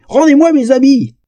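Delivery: high, loud outraged holler; panicked yell. A narrator's reading. Naked troll demands his clothes back, yelling in panic. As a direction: shout it pleading and frantic. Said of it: "hurla-t-il les yeux exorbités".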